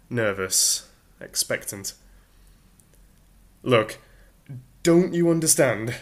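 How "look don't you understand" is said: higher and louder, exasperated shout. Acted. Arthur shouting at Ford about the bulldozers. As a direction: yell it in frustration. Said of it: "shouted Arthur".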